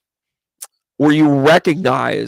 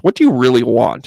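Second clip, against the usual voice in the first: ordinary delivery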